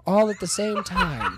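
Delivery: laughing. Real, not acted. Laughing at his own story.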